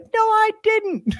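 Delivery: very high, loud, drawn-out whine; protesting little kid. Acted. Kid denying the theft, very high loud protest. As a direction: squeal a slow denial. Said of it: "the kid's like".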